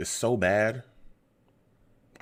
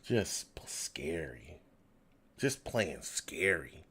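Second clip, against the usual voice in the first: softer, whispered in resignation; fed-up whisper; 4 semitones lower, 9 dB quieter, slower than usual. Real, not acted. Fan whispering that his team plays scary. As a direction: let it slip out as a whisper. Fan muttering about scared play.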